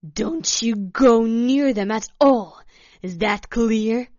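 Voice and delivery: loud, stern order; forceful loud warning; loud announcement. A narrator's reading. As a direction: lay down the law at full volume. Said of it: "Svetlana announced loudly".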